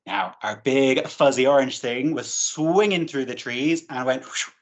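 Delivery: ordinary delivery, nothing marked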